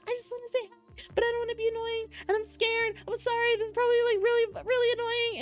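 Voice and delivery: high, tumbling nervous gush; nervous babbling. Retold or imitated. Starstruck fan apologizing to the guest, high nervous babble. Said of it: "immediately I'm babbling".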